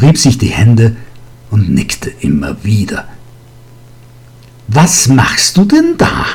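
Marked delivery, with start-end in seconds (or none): 4.7-6.2 hohe, schrille Alte, laut herausgeschrien; gellende Stimme. A narrator's reading. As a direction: alte Lehrerin fährt ihn grell an. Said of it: "Die gellende Stimme". (gellender Ruf einer alten Frau)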